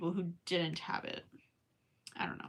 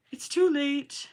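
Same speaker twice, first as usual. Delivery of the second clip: It's too late said higher in a silly put-on voice; 6 semitones higher, 4 dB louder, slower than usual. Shown as a demonstration. Vlogger, tired near midnight, repeats It's too late in a silly put-on voice. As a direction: play It's too late as a mock dramatic character.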